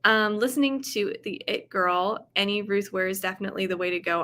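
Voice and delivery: ordinary delivery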